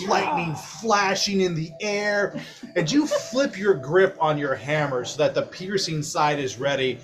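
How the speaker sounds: ordinary talk, nothing marked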